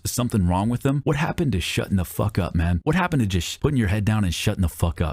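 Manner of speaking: ordinary talk, nothing marked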